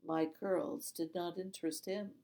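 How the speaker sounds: plain reading voice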